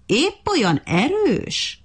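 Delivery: slow, high, drawn-out mocking question. A narrator's reading. Monkey mocks the ant's strength, slow and high. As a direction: sneer at the ant's claim. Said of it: "kérdezte gúnyosan".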